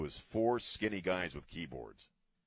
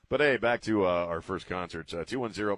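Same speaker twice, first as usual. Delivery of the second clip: ordinary delivery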